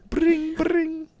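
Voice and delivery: very high sing-song bring bring; phone ring imitation. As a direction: ring like an old telephone.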